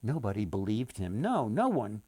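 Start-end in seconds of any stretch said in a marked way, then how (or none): none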